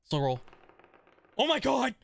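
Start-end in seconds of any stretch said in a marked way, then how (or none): 1.4-1.9 gasp, then a high, crying shout of disbelief; sudden stunned win, shriek it out; shocked gasp. Real, not acted. Sudden huge game win.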